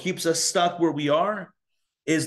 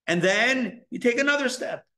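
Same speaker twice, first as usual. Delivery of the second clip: usual speaking voice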